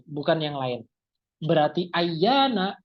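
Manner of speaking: usual speaking voice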